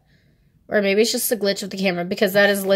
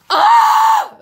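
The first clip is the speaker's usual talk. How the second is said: excited scream; 10 dB louder than usual. Real, not acted. Fan excited over one of her biases, gasp and scream. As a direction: gasp into a very high, loud shriek. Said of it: "I got excited".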